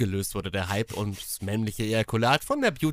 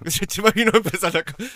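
laughing while talking; 6 semitones higher, 6 dB louder than usual. Retold or imitated. Mimicking friend's line, laughing.

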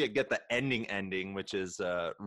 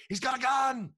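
much higher panicked warning shout; mock cop yell; 4 dB louder than usual. A cop warns the others about a gun, high and panicked. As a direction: alarm call to the others.

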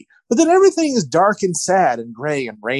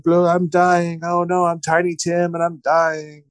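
put-on creepy floating child; 3 semitones higher than usual. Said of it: "all creepily".

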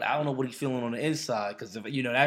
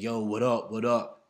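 louder, loose street greeting; casual yo greeting; 6 dB louder than usual. Retold or imitated. Coworker greeting him differently, louder casual street voice. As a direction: drop the office tone, throw a casual yo. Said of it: "Changing their voice and just saying".

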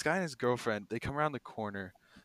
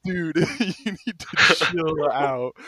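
laughing rebuke; 6 semitones higher, 12 dB louder, much slower than usual. Retold or imitated. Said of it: "And I was like".

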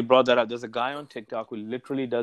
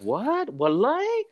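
high, shocked mother's voice, much higher; a mother caught off guard, pitched way up; mom's shocked gasp; 12 semitones higher, much slower than usual. Retold or imitated. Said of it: "And his mom's like".

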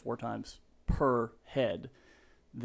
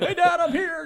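much higher, loud laughing call; hollering across the bullpen to the boss he called Dad; playful yell; 9 dB louder than usual. Retold or imitated. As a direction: announce yourself across the office to tease. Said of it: "I'd scream across the whole bullpen".

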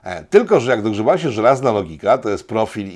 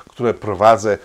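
ordinary talk, nothing marked

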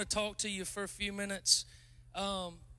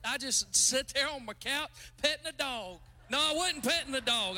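usual speaking voice

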